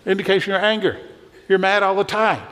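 a hammed-up rant about the road-rager; mock exasperation. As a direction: poke fun at the angry driver. Mock scolding, pitch jumps up.

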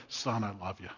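soft, hushed fatherly love; a gentle dad's quiet words; tender father. Retold or imitated. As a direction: say it softly into his shoulder. Father hugs teenage son.

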